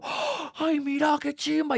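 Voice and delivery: high-pitched, breathy awe at the gadget; imitating his own teenage amazement; amazed schoolboy. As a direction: squeal like a teen seeing magic. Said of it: "y yo".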